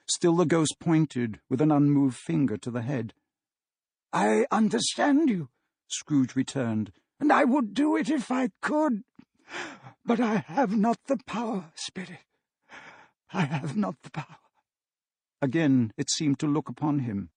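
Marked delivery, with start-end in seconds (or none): 4.1-14.4 put-on higher, strained old man's voice; desperate Scrooge. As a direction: plead that you cannot do it. Scrooge protests to the ghost.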